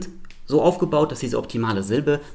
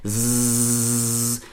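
sustained voiced zzz; bee-like buzz. Shown as a demonstration. Teacher demonstrating vibrating vocal cords, bee buzz. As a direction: buzz on a long, steady S. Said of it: "Das kann man gut zeigen am sogenannten Bienen-S".

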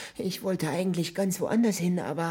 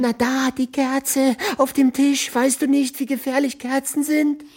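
higher, louder frantic cries; panicked alarm; 6 semitones higher, 6 dB louder than usual. Acted. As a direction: panic over a deadly danger. Stranger still panicked about candle.

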